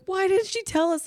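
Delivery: high, startled question; the teachers voiced high and baffled; surprised teachers. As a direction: ask it in high disbelief.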